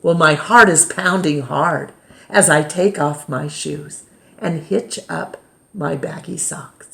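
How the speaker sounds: ordinary delivery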